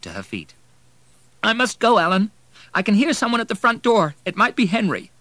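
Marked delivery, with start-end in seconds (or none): 1.4-5.1 high, loud, urgent distress; agitated woman. A narrator's reading. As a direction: break off the call in agitation. Weeping Anne hurries off phone.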